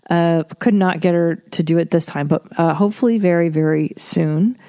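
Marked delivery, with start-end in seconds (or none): none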